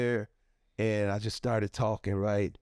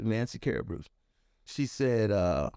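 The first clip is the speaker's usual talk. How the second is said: ordinary delivery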